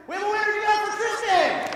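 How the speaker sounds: loud, high winner announcement; excited shout. Real, not acted. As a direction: proclaim the winner to the room.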